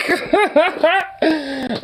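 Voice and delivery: high, helpless cackle; burst of laughter. Real, not acted. Cracking up at a ridiculous little chonk, high laugh. Reacting to a chonky animal picture.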